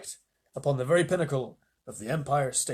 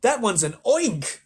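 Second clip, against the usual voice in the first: higher, loud, slow; scream; 6 semitones higher, 5 dB louder, much slower than usual. Acted. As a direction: scream it in horror. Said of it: "screamed the head of the fire department".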